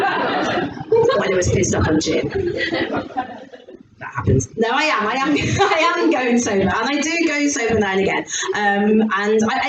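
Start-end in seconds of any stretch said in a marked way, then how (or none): none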